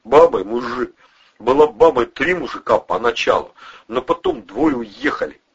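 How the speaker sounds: high, pressured confession; loud, high-pitched blurting; cracking under questioning. A narrator's reading. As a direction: spill it all under threat. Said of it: "кололся Любчик".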